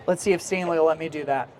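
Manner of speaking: usual speaking voice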